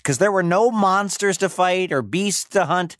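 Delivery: ordinary delivery, nothing marked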